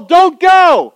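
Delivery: loud high shout begging him to stay; shouted plea. Acted. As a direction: yell don't go at a friend. Luke begging Paul.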